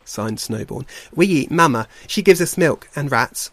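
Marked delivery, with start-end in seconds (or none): 1.1-3.5 lightly voiced cub, higher and louder; earnest little brother. Little bear tells the otter what cubs eat, eager. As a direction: pipe up eagerly with the correction.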